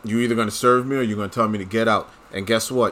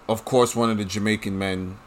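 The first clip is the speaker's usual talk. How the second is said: ordinary talk, nothing marked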